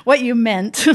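ordinary delivery

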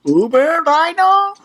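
high, squeaky sudden voice. Pretend. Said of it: "I don't know what that was".

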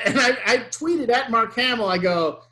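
normal talking voice

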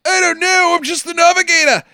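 high-pitched mock Scottish protest; hammed-up high Scottish plea; panicked Scottish navigator. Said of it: "Scottish guy".

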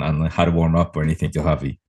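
ordinary talk

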